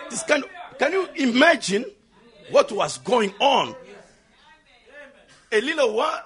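loud sermon building to a full shout; rousing preacher at full force; fervent preaching. Real, not acted. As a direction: urge the congregation on, shouting the key phrases. Preaches, urging church to shout.

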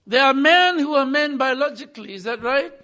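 ordinary talk

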